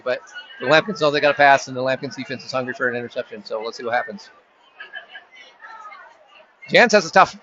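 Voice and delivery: live sports call, running commentary pace; play-by-play. Acted. Announcer calling the game for listeners, live play-by-play. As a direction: narrate each play as it unfolds.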